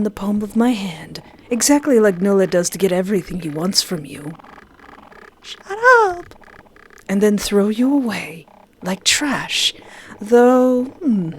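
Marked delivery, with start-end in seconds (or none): 5.4-6.3 very high squeaky "Shut up."; high squeal. Real, not acted. Streamer squeals back.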